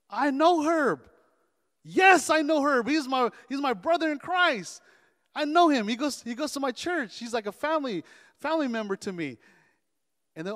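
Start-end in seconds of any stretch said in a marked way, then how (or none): 0.1-9.4 loud, high, excited recognition; emphatic, high-pitched pride in a friend; enthusiastic claim. Acted. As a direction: burst out as if about a beloved brother. Acting out knowing a friend well.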